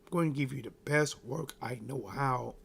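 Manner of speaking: usual speaking voice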